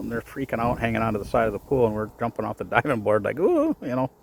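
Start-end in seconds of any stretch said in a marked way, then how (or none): 3.4-3.8 high carefree ooh; gleeful ooh. Retold or imitated. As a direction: toss off a playful ooh. Carefree diver mocking worriers.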